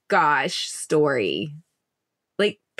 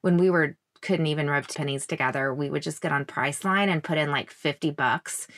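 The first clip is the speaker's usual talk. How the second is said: ordinary delivery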